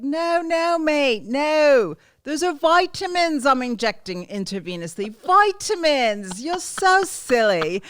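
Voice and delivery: loud, high-pitched British rocker impression; exasperated British accent, drawn out and loud. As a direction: protest in a hammed-up British accent. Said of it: "I can't do [name] without the accent".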